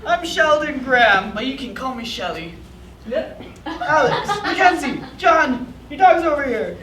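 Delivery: lightly voiced elderly man; a cheerful old grandpa. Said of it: "an elderly grandpa voice calls out".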